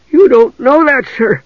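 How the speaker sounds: high, loud frightened whine; high-pitched, panicky guard; whimpering. Acted. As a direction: whimper a protest to your captain. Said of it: "the man whimpered".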